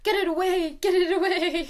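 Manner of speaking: high squealing get it away, half laughing; playful squeal of fear; mock panic. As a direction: squeal like you've seen a needle. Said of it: "I'm like".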